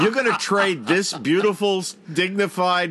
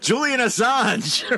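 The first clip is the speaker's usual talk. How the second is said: dramatic reveal; 6 semitones higher, slower than usual. Real, not acted. Big reveal of dinner guest.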